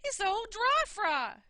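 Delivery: wife screaming at what fell out. Acted. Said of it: "she screamed".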